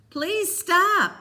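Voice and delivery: high, loud pleading cry; desperate begging. A narrator's reading. Cole begging Peter to stop, high desperate cry. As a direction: beg him to stop.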